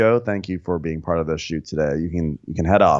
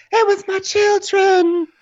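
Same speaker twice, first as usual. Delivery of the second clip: whiny mock wail; 6 dB louder, slower than usual. Mock weepy Jen quote.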